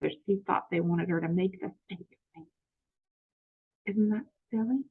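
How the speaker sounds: ordinary delivery, nothing marked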